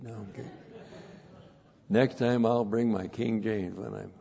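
ordinary delivery

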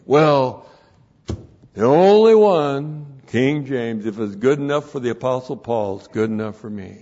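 hammed-up King James only insistence; a mild mock of a stubborn man; insistent convert.

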